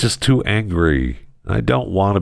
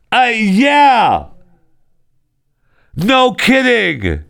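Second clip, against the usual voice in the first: sarcastic outburst; 12 semitones higher, much slower than usual. Real, not acted. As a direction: scoff it big and slow.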